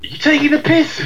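mock Australian accent. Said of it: "imitate the accents that we hear".

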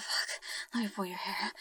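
ordinary talk, nothing marked

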